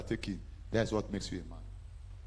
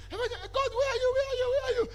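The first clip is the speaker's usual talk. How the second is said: high, urgent repeated question; wife frantic on the phone, pitched way up; panicked wife. Retold or imitated. Wife calling husband after crash, frantic high voice.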